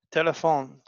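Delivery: normal talking voice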